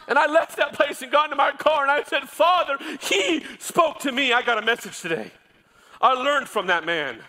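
high, loud, impassioned preacher's cry; preaching at full force. Acted. Pastor preaching the congregation toward tenderness, loud and high. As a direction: shout it from the pulpit with fire.

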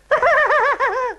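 high, loud silly laugh; villain laugh. A narrator's reading. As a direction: cackle like a delighted fool. Villain laughs after the soaking.

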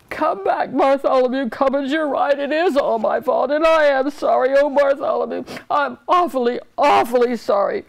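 high, wailing tearful plea; crying king. A narrator's reading. Old king begs his page's forgiveness, crying. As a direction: blubber the apology as a weeping old king. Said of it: "The old king was crying".

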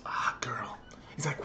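whispered, imitating a friend's reaction; hushed aside. Friend spotting a girl while walking, whispered.

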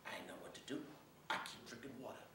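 low, hushed, near whisper; subdued confession. Acted. Soldier confiding his worsening symptoms, subdued. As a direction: drop to a quiet admission. Soldier admits helplessness over failing vision.

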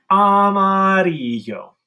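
high, loud and slow armadillo voice. Shown as a demonstration. Teacher voicing a hand armadillo. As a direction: let the hand armadillo squeak it. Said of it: "You can have it walk back".

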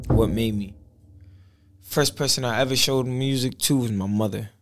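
normal talking voice

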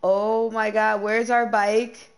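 alarmed outcry, slowed and stretched; real shocked outcry; loud panic. Real, not acted. Realising bike is gone.

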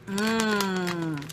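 mumbling through chewing; food-muffled hum; chewing with mouth closed. Real, not acted. Seen 1: Just ate from bag; lips closed, chewing during "Mm", then talks.

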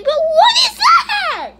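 high, slow yell breaking into laughter; laughing shout. Real, not acted.

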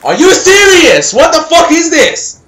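loud, high-pitched disbelief; outraged shouting. Real, not acted. Gamer protests his shots not counting, outraged shouting. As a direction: yell as if robbed of the kill.

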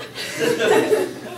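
a burst of high, breathless laughter; high breathy laugh. Real, not acted. Laughs at own joke.

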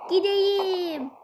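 put-on childish whine. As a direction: plead like an impatient little boy. Kid character begging again.

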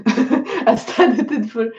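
real amused laugh into the words. Real, not acted. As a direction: laugh while starting to explain. Laughing about her own Polish studies.

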